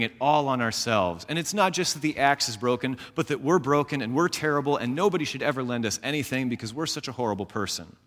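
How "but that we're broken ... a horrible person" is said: usual speaking voice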